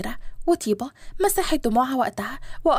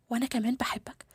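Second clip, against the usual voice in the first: hushed, intimate love answer; tender reply; 2 semitones lower, 9 dB quieter than usual. Acted. Bride returning her beloved's love, hushed and tender. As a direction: give it back very softly.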